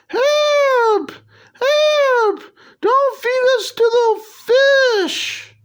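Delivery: very high squeaky cartoon plea; corrected worm voice. Corrected take of the worms' plea, very high and squeaky. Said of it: "So you gotta say it like".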